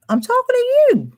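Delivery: high squeaky put-on voice. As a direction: squeak it out in a tiny voice.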